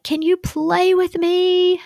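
put-on high, slow pleading child; little girl voice. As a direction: beg like a bored little girl. Bored daughter begging to play.

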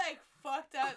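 loud reaction. Real, not acted. Reacting to mom's harsh reading for the ugly guy, loud outburst. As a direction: blurt it out in disbelief.

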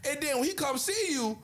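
ordinary talk

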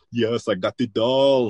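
slow, put-on guilty husband voice; Arnold. Husband lying to his wife about the doll, slow mock impression. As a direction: drag it out in a mock panicked cover-up. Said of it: "And he's like".

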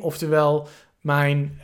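usual speaking voice

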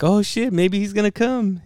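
high-pitched hopeful quote; retold hopeful excitement. Retold hopeful thought about friend.

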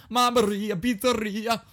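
high, loud cartoon Italian; Italian accent. Shown as a demonstration. Admitting bad Italian, hammed-up Italian accent. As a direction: sing out mock Italian with relish. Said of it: "I can't really do Italian".

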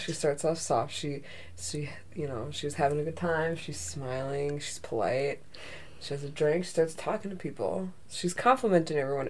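quietly; quiet, soft. Shown as a demonstration. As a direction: speak softly. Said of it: "Very soft".

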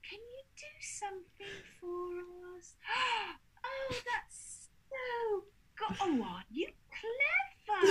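mock cooing, very slow and high; patronising baby talk. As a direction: gush slowly at them as if at a two-year-old. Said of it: "talk to him like a two-year-old".

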